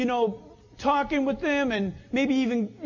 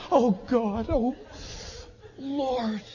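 imitating weeping prayer; slower than usual. Retelling how a friend wept in worship, mock tearful. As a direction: imitate a friend crying out to God. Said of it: "tears are flowing down his cheeks".

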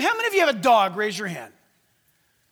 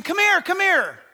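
raised calling voice; calling the dogs; 7 semitones higher, 4 dB louder than usual. Retold or imitated. Preacher retelling calling his dogs, raised call. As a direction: call the dogs back down the street. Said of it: "I had been saying".

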